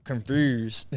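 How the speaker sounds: imitated Memphis accent on "Confused". Shown as a demonstration. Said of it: "Memphis, they be like".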